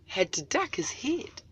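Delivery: ordinary delivery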